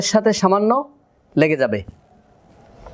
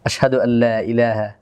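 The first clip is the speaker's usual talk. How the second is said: low, measured recitation; closing Arabic prayer; 7 semitones lower than usual. Acted. Teacher ending the lesson with a supplication, low measured prayer. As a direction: recite the supplication in a lowered, even voice.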